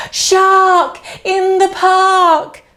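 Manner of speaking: shouted warning, slowed. Acted. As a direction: call it out to the park. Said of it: "at the top of his voice".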